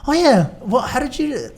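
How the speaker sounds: high, eager surprised questions; curious questions. Retold or imitated. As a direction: pop out a surprised follow-up question. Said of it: "I kinda pick out things like".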